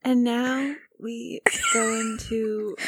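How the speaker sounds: slow, soft spa-calm imitation; mimicking spa voice. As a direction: copy the hushed zen voice. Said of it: "This is my spa voice".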